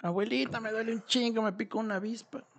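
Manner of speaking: mock a stung child whimpering to grandma; whining hurt kid. Stung boy telling his grandmother it hurts, lightly voiced whine. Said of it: "empieza a gritar de que le duele".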